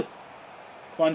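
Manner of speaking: ordinary talk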